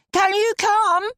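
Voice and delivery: put-on high little girl's voice calling out; child calling. A narrator's reading. Little daughter calling her mother to the door, high child's call. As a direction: sing it out to mummy like a small child. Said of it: "she called".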